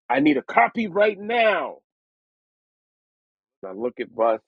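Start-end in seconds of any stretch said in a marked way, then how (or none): 0.1-1.8 much higher, urgent plea; eager demand. Retold or imitated. [name] demanding a copy of the song, high and urgent. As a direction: demand it right now, thrilled. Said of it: "And he was like".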